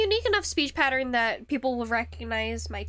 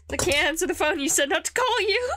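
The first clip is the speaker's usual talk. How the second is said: louder, higher mock protest; 6 semitones higher, 5 dB louder than usual. Imagining a reply to a caller, mock protest. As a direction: push it up and louder in a pretend retort.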